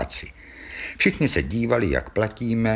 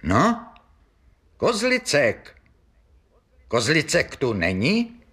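higher-pitched, mildly pressing official; insistent questioning; 5 semitones higher than usual. Acted. Policeman repeating his question to a silent pub, insistent. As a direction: press the silent room for an answer.

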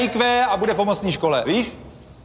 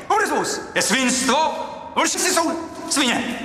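defiant proclamation; 8 semitones higher than usual. Acted. Headmaster repeats it for his friend, emphatic proclamation. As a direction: spell out the slogan in a raised pitch.

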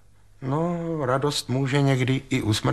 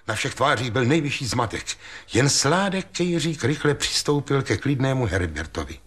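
ordinary delivery

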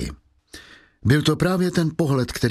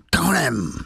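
higher, snarled "Tak honem" like a beast; animal growl; 4 semitones higher than usual. Shown as a demonstration. Javert snarling at Valjean, a beastly growl. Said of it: "Řekl".

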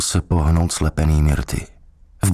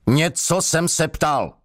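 much higher, slow warning; displeased demand; 12 semitones higher, slower than usual. A narrator's reading. Interrogator presses a silent prisoner, displeased and high-voiced. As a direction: demand an answer, high and drawn out. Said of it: "Hlas už nebyl hluboký, ani nevyjadřoval dobrou náladu".

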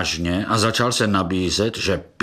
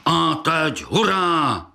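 battle cry; 6 semitones higher, slower than usual. A narrator's reading. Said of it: "vykřikl velký brouk".

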